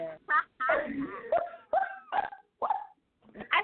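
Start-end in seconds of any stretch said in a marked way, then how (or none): 0.2-2.3 high, rising burst of embarrassed laughter. Real, not acted. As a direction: laugh it off, caught out. Embarrassed after blurting it out.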